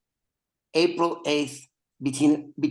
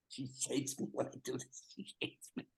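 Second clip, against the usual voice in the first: whispered aside; 17 dB quieter than usual. Real, not acted. Aside about guest.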